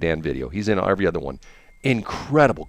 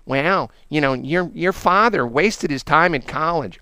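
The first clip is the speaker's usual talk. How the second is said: lightly voiced sneering grandmother, higher; slow, higher-pitched mock put-down; 6 semitones higher, slower than usual. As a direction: imitate a grandmother belittling her son. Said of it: "always making fun of my dad".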